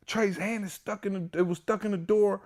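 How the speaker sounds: high frantic retold panic; panicked. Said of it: "yo, I'm so panicked".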